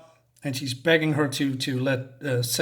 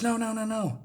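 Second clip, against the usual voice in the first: high, hushed string of panicked refusals; mock panicked pleading; 6 semitones higher, 7 dB quieter than usual. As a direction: protest softly in acted panic. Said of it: "Nomi is, is panicking".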